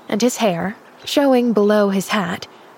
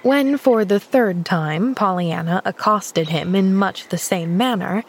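ordinary delivery, nothing marked

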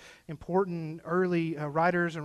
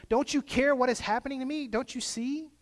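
higher, pleading lament to God; mock anguished plea; 8 semitones higher than usual. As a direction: cry out to God as Job, voice raised. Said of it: "he sort of cries out to God and says".

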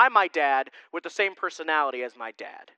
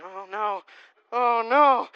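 mock worried "oh no"; 4 semitones higher, 4 dB louder, slower than usual. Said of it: "Poor Gail is sitting in the back going".

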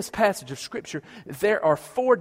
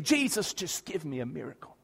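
ordinary talk, nothing marked

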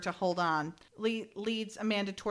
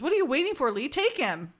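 higher and louder, pushing him to act; urgent urging; 6 semitones higher, 4 dB louder than usual. Retold or imitated. Retelling Billy urging Lee to shoot, pitched high and pressing. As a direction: hurry him along, keyed up.

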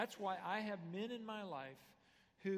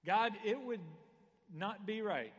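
louder and higher, pressing each word; emphatic prayer; 5 semitones higher, 10 dB louder than usual. Retold or imitated. Nehemiah telling God he won't retaliate, loud and fervent. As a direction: preach it out as Nehemiah's bold plea. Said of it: "is essentially saying is".

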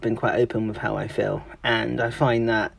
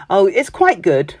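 slow, higher, louder "Oh, it's quite good"; damn it with faint praise; understated praise; 6 semitones higher, 5 dB louder than usual. Retold or imitated. A Brit judging something, mock polite praise. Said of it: "the Brits will say".

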